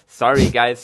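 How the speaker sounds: loud laugh into an apology. Real, not acted. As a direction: laugh it off loudly while apologizing. Laughing apology after dropout.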